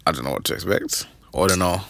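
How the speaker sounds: ordinary talk, nothing marked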